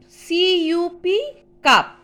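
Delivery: ordinary talk, nothing marked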